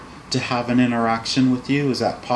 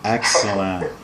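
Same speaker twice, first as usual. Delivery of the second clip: chuckling. Real, not acted. Shares a laugh with client.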